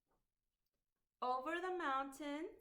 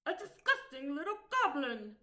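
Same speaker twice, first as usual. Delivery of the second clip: louder, revolted innkeeper; disgusted innkeeper; 3 semitones higher, 6 dB louder than usual. Acted. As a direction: spit out your disgust.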